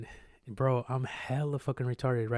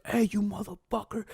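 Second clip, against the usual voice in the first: high-pitched imitation of an outraged passerby; high retold holler; mock angry yell; 8 semitones higher than usual. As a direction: call out after a thief, hammed-up. Jogger calls out at thieves.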